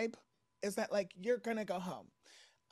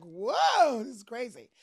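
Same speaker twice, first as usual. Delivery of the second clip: surprised; 5 semitones higher, 11 dB louder, slower than usual. Retold or imitated. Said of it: "then I would've been like".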